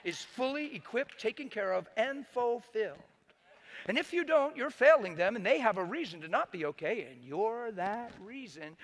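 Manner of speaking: loud, swooping, high-pitched sermon delivery; forceful preaching. Acted. Preacher voicing fifty-fifty marriage teaching, forceful and insistent. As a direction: hammer each clause at the congregation, voice leaping up.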